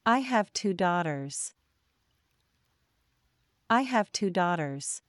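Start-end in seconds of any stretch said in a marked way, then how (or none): none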